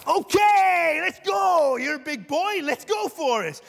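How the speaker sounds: loud, high, cocky challenge shouted out; defiant shout. Retold or imitated. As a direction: call them out loudly, itching for a fight. Ready to argue with someone.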